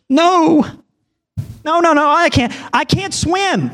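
put-on high, pleading child voice refusing; a scared kid begging not to jump; high panicky child saying no; frightened little boy.

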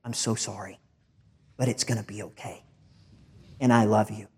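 God gently consoling someone; tender comfort. Acted. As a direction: come alongside and console. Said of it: "God's gonna come alongside you".

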